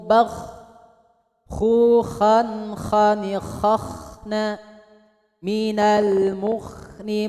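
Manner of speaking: syllable-by-syllable kha khi khu with throaty kh; full recitation demo. Shown as a demonstration. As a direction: recite the line slowly and clearly. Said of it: "oke, lanjut". (contoh bacaan lengkap)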